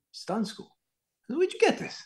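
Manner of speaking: hushed, high-pitched disbelief; retold astonishment. Teacher sees pupil's stunt school printout, high-pitched amazement. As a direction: question it softly, voice climbing very high.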